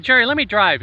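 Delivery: retold friend asking for the wheel; friend taking the keys. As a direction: insist on driving the drunk friend home. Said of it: "He said".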